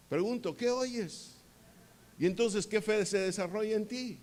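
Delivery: normal talking voice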